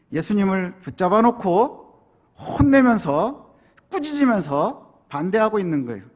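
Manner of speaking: ordinary delivery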